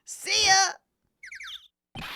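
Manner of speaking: loud high cartoonish getaway; flustered Larry. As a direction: try to dodge and flee in a hurry. Voices Larry character.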